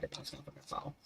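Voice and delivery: hushed panicked whisper under the breath; startled whisper. Real, not acted. Whispering in alarm as something is about to fall on her. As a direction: breathe it out, afraid it will drop.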